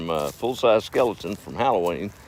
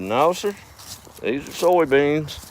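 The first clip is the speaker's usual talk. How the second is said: farmer voice; 7 semitones higher, slower than usual. Acted. Farmer answers preacher in joke.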